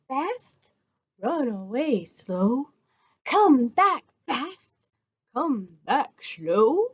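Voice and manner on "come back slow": ordinary talk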